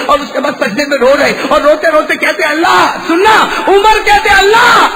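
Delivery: rising to a high, loud cry; preacher climbing to a peak; building full-force preaching. Acted. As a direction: build up to a shouted call on Allah.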